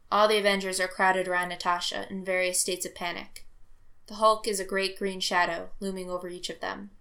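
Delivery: usual speaking voice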